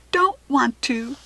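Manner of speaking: slow, sulky whispered refusal; sassy little tiger cub; sassy growl. A narrator's reading. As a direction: pout it out like a stubborn cub. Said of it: "Sheba's growl was sassy".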